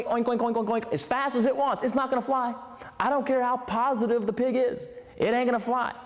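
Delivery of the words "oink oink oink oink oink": quick spoken oink oink sounds; playful oinking; pig oinks. Retold or imitated. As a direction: mimic a running pig. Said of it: "The pig can run".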